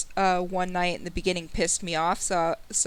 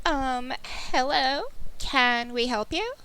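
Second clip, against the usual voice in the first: put-on young girl voice; 4 semitones higher, slower than usual. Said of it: "This is the voice now".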